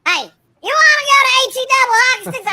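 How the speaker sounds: high, loud, fast put-on divine voice; God's voice. God threatens the streamer with hell, high and fast. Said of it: "And he said".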